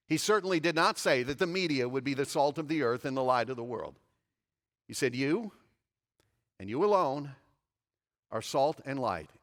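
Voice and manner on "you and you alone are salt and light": "You", pause, "and you alone" weighted; stress on you. Shown as a demonstration. Preacher voicing Jesus with the emphatic "You". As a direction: pause after each "you". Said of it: "the word you is in what's called the emphatic position".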